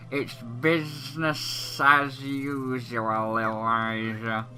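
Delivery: slow, drawn-out salesman voice; unhurried game merchant talking terms; businesslike salesman. Acted. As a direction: stretch the words while politely refusing. Voices Trouser refusing freebies.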